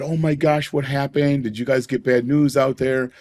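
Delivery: concerned stranger. Retold or imitated. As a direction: ask it like you fear bad news. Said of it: "somebody came over and said".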